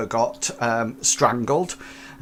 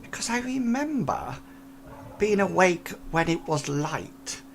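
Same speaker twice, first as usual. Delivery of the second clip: put-on higher, hushed old lady telling; soft and spooked, like a frail old woman; 6 semitones higher, 5 dB quieter than usual. Old lady recounts seeing figure.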